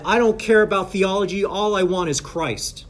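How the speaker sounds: mock-earnest declaration from an audience member; acted objector. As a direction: raise the voice slightly to declare it.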